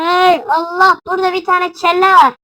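high, loud frightened cry; startled alarm. Real, not acted. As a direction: cry out at a grisly find. Finds a head in game. (korkuyla bağırarak)

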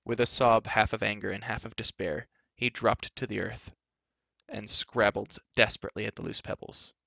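usual speaking voice

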